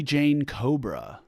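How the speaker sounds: usual speaking voice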